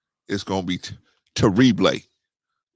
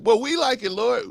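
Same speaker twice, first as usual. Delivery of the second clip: people talking back; 11 semitones higher than usual. Retold or imitated. People answering the Lord.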